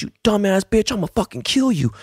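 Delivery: high-pitched imitation of an enraged attacker; mock furious threat. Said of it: "and he goes".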